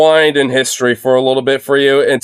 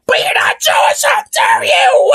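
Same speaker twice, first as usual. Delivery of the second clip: shrill falsetto mockery of the woman, far higher and shouted; mocking high shriek. Streamer mocks the protester shouting at her, in a high shrieking falsetto. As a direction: squeal it like a hysterical heckler. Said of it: "And she's like".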